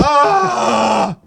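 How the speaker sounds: loud, high sustained scream; long high shriek. Pretend. Hosts screaming after the slippery slope catchphrase, long high shriek. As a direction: hold a piercing playful yell.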